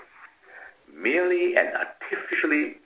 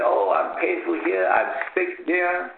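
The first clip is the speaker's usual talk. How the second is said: pretending to be sick; 7 semitones higher than usual. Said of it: "he pretended to be sick and bedridden".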